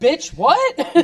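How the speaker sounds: loud, high burst of disbelief; hammed-up indignant shout; mock outraged retort. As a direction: shout it back in pretend outrage. Imagined outraged retort to stranger.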